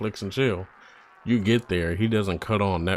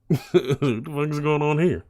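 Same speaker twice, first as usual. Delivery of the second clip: laughing; 7 semitones higher, 3 dB louder than usual. Retold or imitated. Imagining meeting underground spirits.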